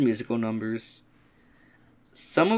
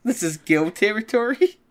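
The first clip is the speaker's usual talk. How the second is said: higher, louder mock boast; mock territorial boast; 8 semitones higher, 4 dB louder than usual. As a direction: claim the turf loudly. Quoting racist musical line.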